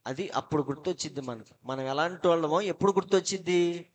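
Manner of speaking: usual speaking voice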